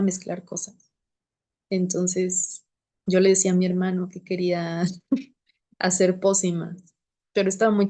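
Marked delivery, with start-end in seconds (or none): none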